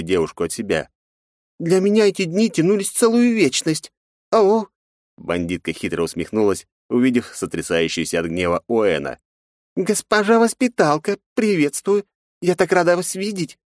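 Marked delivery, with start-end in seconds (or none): 1.6-4.7 flirty femme fatale. Acted. Жанна кокетничает. 9.7-13.6 mocking sweet greeting. Бандитка дразнит воспитательницу.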